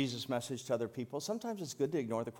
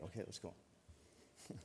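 breathy whisper, slow, under the breath; whispered aside; 6 semitones lower, 16 dB quieter, slower than usual. Real, not acted. Pastor whispering to himself after a joke, hushed aside. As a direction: drop to a whisper to move on.

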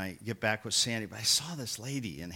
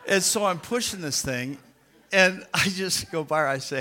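ordinary delivery

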